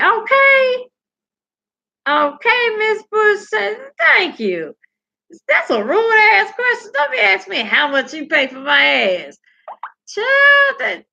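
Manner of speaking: high, loud sassy comeback; sassy retort. Retold or imitated. Miss Bush answering a rude question, high and sassy.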